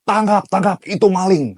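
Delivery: high, loud accusing yell; shouted accusation at the gate; retold shout. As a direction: yell it like catching a thief. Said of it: "di depan pagar dikatakan".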